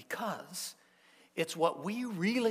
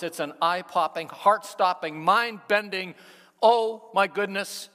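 building crescendo; 3 semitones higher, 9 dB louder than usual. Real, not acted. Building climax of fear.

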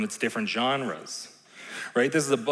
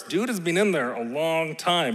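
normal talking voice

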